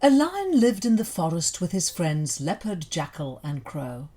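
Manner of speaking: plain reading voice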